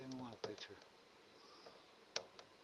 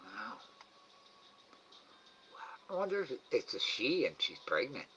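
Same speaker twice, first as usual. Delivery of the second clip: ordinary delivery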